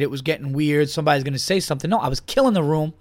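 ordinary talk

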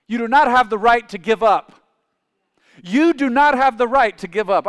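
loud, high preaching declaration; forceful exhortation. Real, not acted. Preacher urging congregation not to give up, forceful. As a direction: drive it home at full volume.

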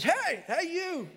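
high squawky parrot call. Acted. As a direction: sound like a bird calling someone over. Talking parrot in joke.

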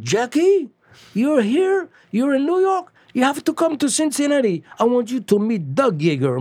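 high, eager voice pressing a friend; urging a friend to come over, high and thrilled; excited Freddie on the phone. Retold or imitated. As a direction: voice jumps way up with excitement. Freddie excitedly phoning narrator.

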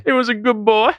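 high mock American interviewee accent; muddled American accent, rising at the end; mixed American accent. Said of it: "That was a mix of accents".

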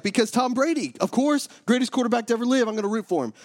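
imitating an excited boy, pitched high; eager kid. Boy telling his friend's dad about his favorite quarterback, high eager retort. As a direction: pipe up like a kid sticking up for his hero.

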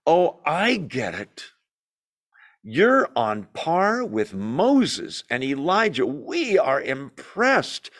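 slow, drawn-out words as a dawning realization; awestruck Peter. Acted. Peter blurting to Jesus before he thinks, slow and impressed. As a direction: stretch each key word, playing the impressed disciple. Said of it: "Peter says".

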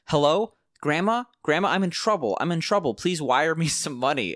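lightly hammed-up pleading grandchild; generic twenty-something caller. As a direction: imitate a young scam caller begging for help. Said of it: "twenty-something-year-old voice".

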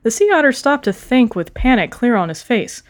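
ordinary delivery, nothing marked

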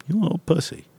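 high mock-pitying jeer; mocking dog. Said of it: "would just looked at him like".

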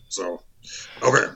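ordinary talk, nothing marked

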